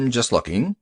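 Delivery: plain reading voice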